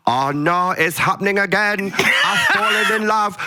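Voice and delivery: badly faked Sheffield lilt; bad Sheffield accent. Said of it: "like, a Sheffield accent".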